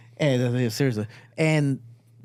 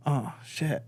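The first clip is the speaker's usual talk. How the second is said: hushed dread; 8 dB quieter than usual. Retold or imitated. Hearing angry dad, dread.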